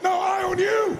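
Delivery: high, loud, dominant "I own you"; forceful shout. Shown as a demonstration. Speaker showing the crowd how to claim it, shouting. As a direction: shout it to win the challenge. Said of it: "say it like you mean it".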